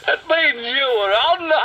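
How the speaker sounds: high, gleeful laughing voice; high giggly taunt; giggling dragon. A narrator's reading. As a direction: crow it, then burst out laughing. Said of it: "giggled Charlton".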